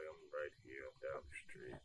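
very soft, low and small; sheepish mumble. Retold or imitated. Son answering his angry mom, soft and guilty. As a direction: shrink into a guilty mutter.

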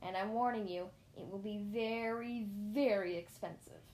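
slow, drawn-out threat; mildly shouted, heavily drawn-out warning. A narrator's reading. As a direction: stretch out the warning for menace. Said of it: "he shouted at me".

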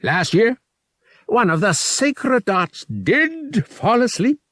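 a big, pompous alien creature savoring his words; alien priest voice. A narrator's reading. Verotil tells funny memory.

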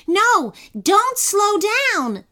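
high, loud, drawn-out yell; urgent yell. A narrator's reading. Kenny to sloth with angry man behind, urgent yell. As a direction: yell a desperate correction. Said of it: "yelled Kenny".

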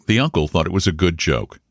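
usual speaking voice

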